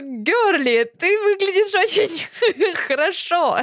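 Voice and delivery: put-on teasing girlfriend voice, laughing through it; mock compliment. Host mocking the cartoon outfit, playful laughing compliment. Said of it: "И я такая, типа".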